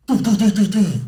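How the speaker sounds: high, loud gunshot noises; imitated gunshots. Mimicking the rifle shots that dropped the bull, loud and high. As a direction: bang out each shot with the mouth.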